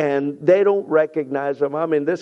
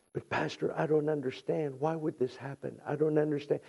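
soft, small questioning; quiet bewilderment; 8 dB quieter, faster than usual. Acted. Wounded believer questioning the pastor, quiet bewilderment. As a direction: voice the doubt gently, well under preaching level. Voices a wounded church member's doubt.

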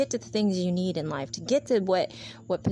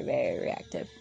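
whispered; 10 semitones lower, 4 dB quieter than usual. Real, not acted. Whispered aside.

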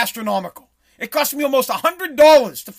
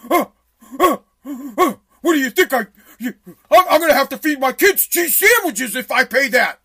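mock shocked customer, louder, with a laugh; 4 dB louder than usual. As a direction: ham up their outrage at the price. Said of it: "they go".

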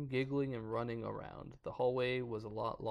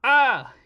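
sudden shrill mock scream; loud scream; 13 semitones higher, 20 dB louder than usual. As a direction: shriek like you're scared. Mock scream after scare line.

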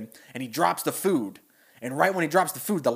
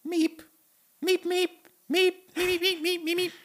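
very high, squeaky meeps; gentle childlike monster meeping; Beaker meep. Retold or imitated. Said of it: "all it says is".

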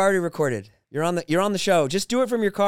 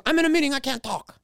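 imitating Susie; 7 semitones higher than usual. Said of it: "She goes".

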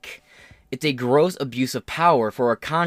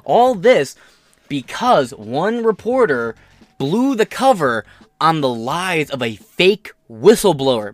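loud rant; 3 semitones higher, 7 dB louder, slower than usual. Real, not acted. Building rant.

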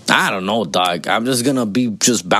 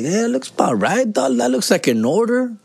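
approving dad; 11 semitones higher than usual. Retold or imitated. If I look over, like.

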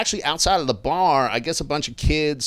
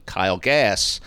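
ordinary talk

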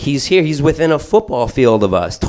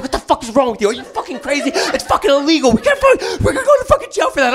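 much higher, laughing, rushed; mock frantic scold; faster than usual. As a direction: panic at her while running. Scolding wife while fleeing.

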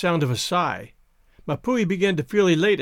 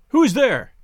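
higher call into the dark; alarmed cry; 5 semitones higher, 4 dB louder than usual. A narrator's reading. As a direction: cry out a sharp challenge. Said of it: "Mapui cried".

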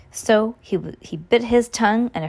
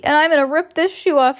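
higher, louder playful threat; feigned joking; 7 semitones higher, 5 dB louder than usual. A narrator's reading. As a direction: announce it loudly as a pretend joke. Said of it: "as if he were joking".

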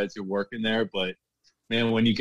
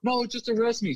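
high-pitched mock pleading demand; insistent arrestee, imitated; 12 semitones higher than usual. Bartender voicing a customer to security, high and insistent. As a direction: imitate a worked-up man daring the guards. Acted stand-off with security guards.

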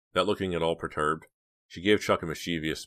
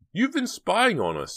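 put-on high, loud, outraged girl; scandalized sister's outburst; indignant accusation; 5 dB louder than usual. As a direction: accuse him in a high, drawn-out cry. Dina accuses her eavesdropping brother.

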